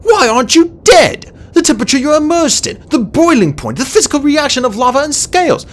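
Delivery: high, loud, incredulous babbling; frantic scientific rant; agitated outburst. A narrator's reading. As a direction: pour out objections in flustered disbelief. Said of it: "Starflight burst out".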